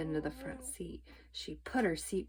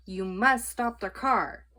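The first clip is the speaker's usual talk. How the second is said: slow, louder stern command; shouting; 3 semitones higher, 7 dB louder than usual. A narrator's reading. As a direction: shout the order with a stern edge. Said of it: "shouted Officer Tomalolo".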